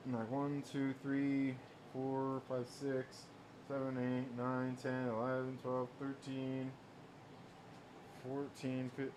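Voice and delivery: soft muttered count; counting under breath. Real, not acted. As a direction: count quietly to yourself. Counting boxes under his breath.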